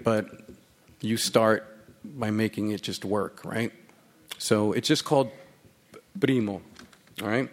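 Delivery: normal talking voice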